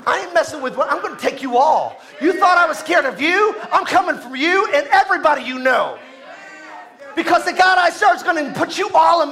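rising, forceful challenge to the enemy; fired-up defiant preaching. Acted. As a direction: preach it as David taking them all on.

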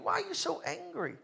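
hushed, high-pitched question; soft puzzlement. Acted. Preacher wondering at forgiven Christians, soft puzzled question.

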